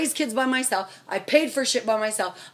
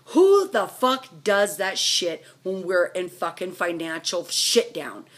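ordinary talk

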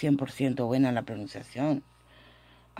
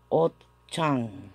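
ordinary talk, nothing marked

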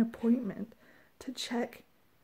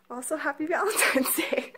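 laughing; 3 semitones higher, 7 dB louder than usual. Real, not acted. Laughing Valentine's greeting to camera.

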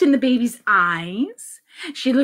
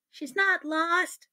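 higher, soft, slow correction; matter-of-fact boy; 6 semitones higher, 8 dB quieter, slower than usual. Acted. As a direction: correct mom slowly and softly. Ben corrects his mother.

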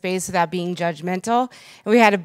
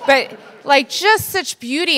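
emotional swell; 6 semitones higher, 4 dB louder than usual. Real, not acted. Tearful emotional tribute.

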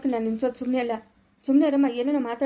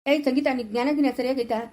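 ordinary delivery

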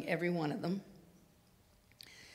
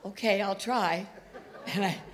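higher, amused and laughing; 4 semitones higher, 4 dB louder than usual. Real, not acted. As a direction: chuckle at the absurd situation. Laughing retelling payphone absurdity.

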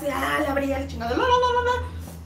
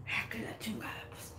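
resigned whisper; 4 semitones lower, 17 dB quieter than usual. Retold or imitated. Resigned muttered curse.

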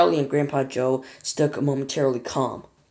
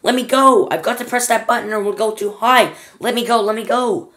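shrieking; 6 semitones higher, 6 dB louder than usual. Acted. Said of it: "shrieked Grandma Josephine".

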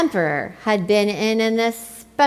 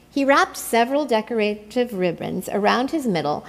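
plain reading voice

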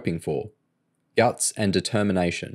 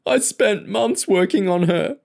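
sobbing; 12 semitones higher, 3 dB louder than usual. A narrator's reading. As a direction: blubber it like a heartbroken child. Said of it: "he sobbed".

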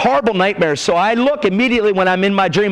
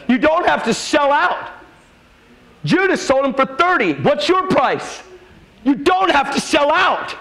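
raised, driving preacher's exhortation; preaching at full force; 5 semitones higher than usual. Acted. Preacher urging the congregation to live without compromise, fervent and raised. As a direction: press the crowd hard, word by word.

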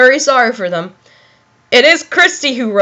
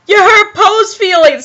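put-on higher, whiny childlike complaint; lightly voiced wounded toy character; hurt Teletubby; 6 semitones higher than usual. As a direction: pipe up as a hurt little creature.

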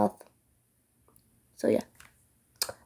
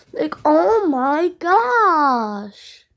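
drawn-out delight; 5 semitones higher, much slower than usual. Real, not acted. Raving about tasty food.

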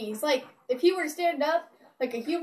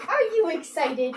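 ordinary delivery

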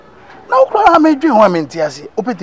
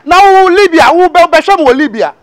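heated mockery; 8 semitones higher, 4 dB louder than usual. Heated argument between friends.

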